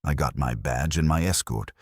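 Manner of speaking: plain reading voice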